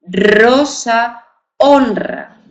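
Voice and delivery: rosa and honra with a strong rolled r; teacher modelling the strong r sound; strong trilled r. Shown as a demonstration. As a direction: roll the r hard in rosa and honra. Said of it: "se pronuncia más fuerte".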